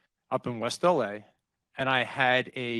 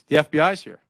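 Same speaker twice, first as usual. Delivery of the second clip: louder, mock-panicked insistence; retold panic; 2 semitones higher, 7 dB louder than usual. Wife repeating the news on the phone, retold panic. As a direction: hit it harder, imitating a panicked wife. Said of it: "it's my wife in a panic".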